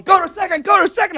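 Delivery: high, loud, urgent repeated order; coach yelling. Acted. First base coach sending the runner on, urgent yell. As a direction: urge the runner on frantically. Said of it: "the coach is going".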